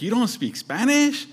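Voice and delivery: high, incredulous imitation of a taunt; mocking. Counter guy making fun of a wife's Spanish, mocking. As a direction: imitate him jeering, pitched well up. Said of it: "he started mocking her".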